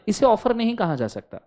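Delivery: ordinary talk, nothing marked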